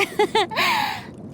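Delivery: high little laugh; giggle, pleased with yourself; self-pleased giggle. Acted. Pleased laugh at own surfing talent.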